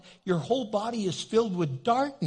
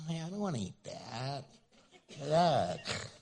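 disgusted whine; 4 semitones lower, 7 dB quieter than usual. Retold or imitated. Spoiled person refusing food.

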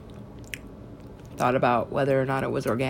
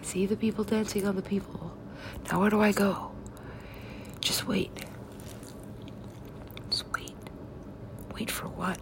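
very soft, near-whispered waiting; secretive half-whisper; hushed exchange; 4 semitones higher, 11 dB quieter than usual. Acted. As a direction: play it hushed, as if waiting for a cue.

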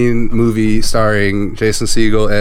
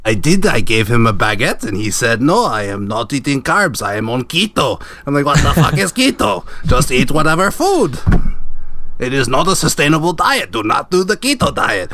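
whale caller in a put-on foreign accent; indignant mock-accented storytelling; Martin; 3 semitones higher than usual. Whale caller recounting a row about keto, put-on accent.

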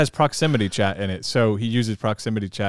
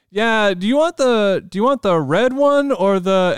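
put-on kid voice; 10 semitones higher, 3 dB louder than usual. Kid offering mom a drink.

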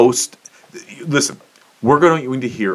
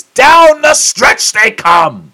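drawn-out announcer cry, much higher and louder; stretched, booming horse-race call; 13 semitones higher, 8 dB louder, much slower than usual. Pretend. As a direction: call it like the final furlong.

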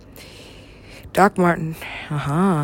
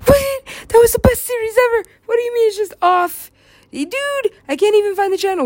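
high, whiny acted protest; mock outraged viewer; 13 semitones higher than usual. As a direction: protest in a much higher fan voice. Acting out viewers losing the series.

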